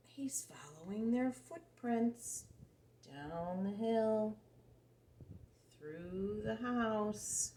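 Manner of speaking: slow hushed tracking, drawn out; slow suspense whisper. Acted. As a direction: stretch each step out to build suspense. Suspense while reading to a child.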